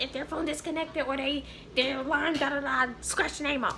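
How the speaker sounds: slightly high, dismissive mimicry of a boss; mock lecturing. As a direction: parrot the boss's instructions mockingly. Mimics supervisor's repeated instructions.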